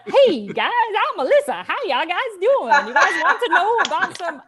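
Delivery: mock her bubbly stage greeting; a hint of an upbeat welcome; impression of Melissa. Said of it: "My impression is".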